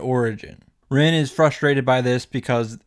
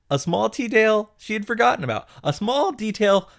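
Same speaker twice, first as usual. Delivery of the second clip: high, quick emphasis; 9 semitones higher than usual. Real, not acted. Emphatic, outraged retelling.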